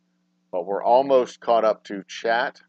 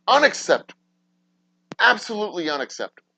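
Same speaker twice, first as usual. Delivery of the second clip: mock verdict; 7 semitones higher, faster than usual. Real, not acted.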